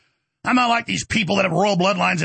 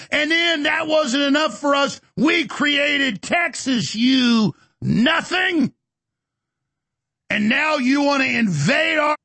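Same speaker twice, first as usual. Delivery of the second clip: furious shout; 8 semitones higher, slower than usual. Real, not acted. Shouting down his critics, a furious high yell. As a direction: hammer each word at the enemy, shouting.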